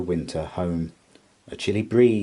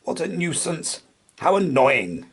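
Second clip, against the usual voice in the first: a mildly grumbling hedgehog, higher; 8 semitones higher than usual. A narrator's reading. Hedgehog stuck with a nut grumbles lightly. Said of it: "a grumbling voice".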